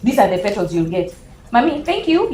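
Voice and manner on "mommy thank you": put-on grateful child.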